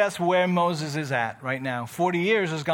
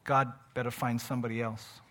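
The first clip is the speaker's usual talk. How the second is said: defeated self-talk; 7 semitones lower, 7 dB quieter than usual. Retold or imitated. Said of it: "telling yourself".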